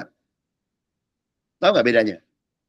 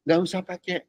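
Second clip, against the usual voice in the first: ordinary talk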